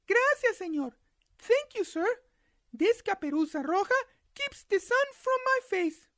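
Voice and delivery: put-on high girl's voice; little girl voice.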